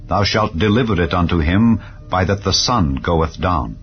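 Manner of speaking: ordinary talk, nothing marked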